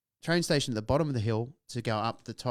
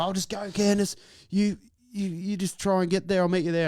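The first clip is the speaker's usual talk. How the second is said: high, loud retold shout; calling out urgently; 9 semitones higher, 5 dB louder than usual. Calling to girlfriend while sprinting off.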